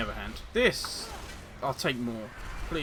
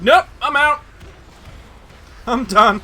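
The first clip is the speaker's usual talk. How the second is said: high shouted nope, bailing out; panicked tank voice; 9 semitones higher, 13 dB louder, slower than usual. Retold or imitated. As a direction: squeal it like a tank fleeing. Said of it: "It's like".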